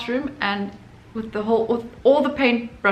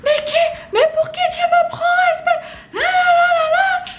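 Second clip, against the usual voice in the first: much higher, shrill-pitched mock yell in French; imitated screaming teacher; 5 dB louder than usual. Art teacher screaming at a student in class, mock yell. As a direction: shriek it like a furious teacher losing it. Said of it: "She's yelling at me like".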